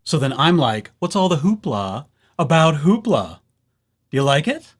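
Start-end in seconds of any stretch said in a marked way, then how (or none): none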